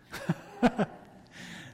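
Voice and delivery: breathy burst of laughter. Real, not acted. Laughs at own joke.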